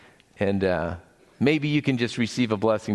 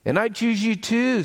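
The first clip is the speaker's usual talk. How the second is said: high, bright-pitched storybook father chiming in; cheerful affirmation; 12 semitones higher than usual. Acted. Father elephant arriving home to his child, cheerful and high. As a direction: chime in eagerly, pitched well up.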